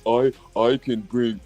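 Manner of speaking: mock stammering, halting applicant; nervous applicant. Mocking a timid applicant stammering through an interview. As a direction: stammer like a timid job seeker. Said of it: "Why your bitch ass going in there trying".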